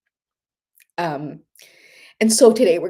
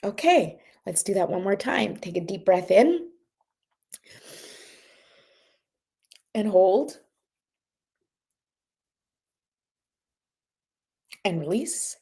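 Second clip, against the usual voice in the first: guided breathing exercise. Acted. Leads breathing meditation.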